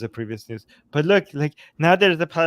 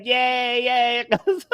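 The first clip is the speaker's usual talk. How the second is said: mock cheer; 5 semitones higher, slower than usual. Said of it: "She's, like, smiling".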